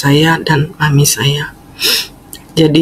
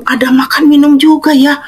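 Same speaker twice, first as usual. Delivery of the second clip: lightly played happy old mother; delighted mother; 10 semitones higher than usual. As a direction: act out mom's childlike delight.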